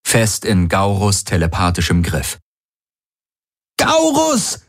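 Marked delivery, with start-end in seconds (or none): 3.8-4.6 high desperate scream; screaming his name. A narrator's reading. Kirai screams at the telepath in despair. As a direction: shriek his name in horror. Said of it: "schrie Kirai".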